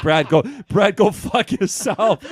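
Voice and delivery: hammed-up brand telling Brad off; mock brush-off. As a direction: pretend company snapping back at Brad. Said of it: "they responded like".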